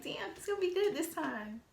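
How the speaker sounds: high-pitched, giddy little squeal of hope; hopeful excitement. Retold or imitated. Woman reacting to him wanting another try, hopeful excitement.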